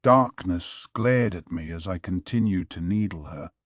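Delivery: ordinary delivery, nothing marked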